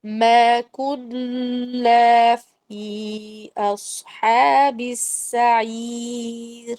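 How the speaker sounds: slow melodic tajweed chant; measured Quranic recitation. Shown as a demonstration. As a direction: recite it slowly, stretching the vowels.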